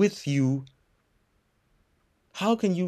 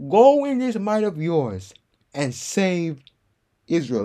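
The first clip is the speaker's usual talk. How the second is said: louder, driving sermon delivery; fervent preaching; 6 semitones higher, 5 dB louder than usual. Real, not acted. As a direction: preach it with full conviction. Preaching builds with force.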